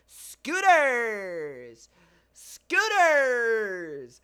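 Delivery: very loud, high, drawn-out holler falling away; street seller's shout. Retold or imitated. Podcaster copying a Bahamas street seller, loud drawn-out shout. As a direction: holler it like a vendor working a crowd. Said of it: "I'm gonna try to copy exactly what he said".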